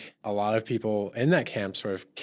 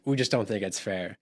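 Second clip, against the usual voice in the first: ordinary delivery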